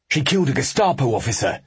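blunt soldier reporting. A narrator's reading.

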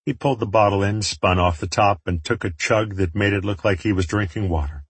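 ordinary delivery, nothing marked

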